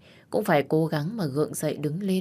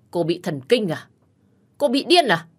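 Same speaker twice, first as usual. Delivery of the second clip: sharp, higher, louder demand; angry retort; 3 semitones higher, 6 dB louder than usual. Acted. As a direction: snap back in disbelief. Heated quarrel with Vi. (vặn lại giận dữ)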